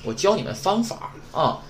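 normal talking voice

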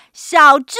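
loud, sharp scolding call; mother's piercing shout; shrieked name. A narrator's reading. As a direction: yell the name to stop someone dead. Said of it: "传来一声尖叫".

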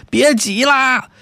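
high, slow panicked shout; anxious shout. Acted. Young man on a crowded bus yells at the crowd, high panicked shout. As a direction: cry out desperately as the crowd pushes. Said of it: "着急的大喊呐".

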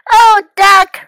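high, loud exclamation of relief; joyful cry. A narrator's reading. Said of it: "Froggy cried".

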